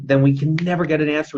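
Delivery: ordinary talk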